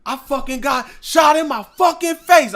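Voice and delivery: high, loud frantic shout; retold panicked scream in the face; panicked yell. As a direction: yell it like you just got shot. Retelling panic after being shot.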